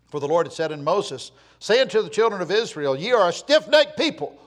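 loud, high preaching declamation; forceful scripture reading. Acted. Preacher reads God's rebuke of Israel, forceful scripture reading. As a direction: thunder the verse out to the congregation.

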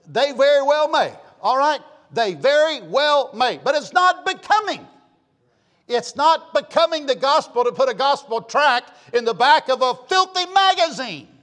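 loud, high, indignant pulpit cry; full-force preaching. Real, not acted. Preacher condemning tracts in filthy magazines, loud and indignant. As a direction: thunder it out to the congregation.

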